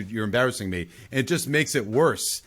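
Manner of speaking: ordinary talk, nothing marked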